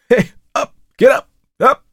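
high, loud shouts to a horse; urgent calling out. A narrator's reading. Frightened rider urges his horse on, shouting high. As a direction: shout in short, high bursts to drive it on.

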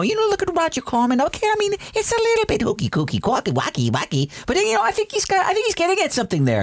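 high put-on Scorsese voice, playful on the rhymes; Scorsese impression. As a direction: Scorsese defending Roger Corman, way up and fast at the end. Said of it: "Scorsese talk about the worst movies and go".

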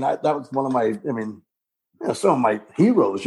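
ordinary delivery

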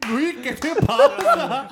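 high, slow words through laughter; laughing. Real, not acted. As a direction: laugh while asking. Hablando entre risas. (entre risas)

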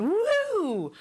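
high excited woo; playful woo. Retold or imitated. Therapist playing with shaving cream, a high delighted woo. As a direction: whoop it up for the child.

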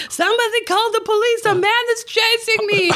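a playful fake alarm to the passengers; mock panic. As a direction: pretend to be chased, running off squealing.

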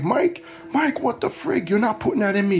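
high, rising retold cry of disbelief; alarmed protest. Patient to nurse as the IV smokes, alarmed. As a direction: replay the panicked objection, pitch jumping up.

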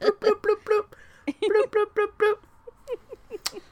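high squeaky bloop noises. Retold or imitated. Tear refiller making cartoon bloop sounds up high. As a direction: pop out tiny tear drops, high and cute. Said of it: "he's got little".

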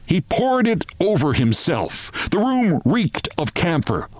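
ordinary delivery

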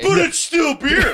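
high-pitched shout. Real, not acted. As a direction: yell it in protest. Heated beer argument.

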